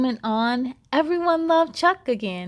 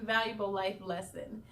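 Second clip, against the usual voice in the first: plain reading voice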